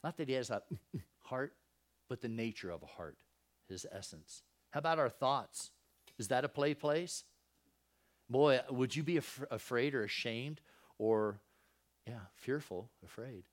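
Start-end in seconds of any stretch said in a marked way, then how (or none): none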